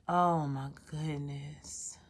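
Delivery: whispered oh my goodness, low and soft; breathy whisper. Real, not acted. Venting to viewers about his wife, soft whisper. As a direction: exhale it under your breath in disbelief. Exasperated aside after feminine energy.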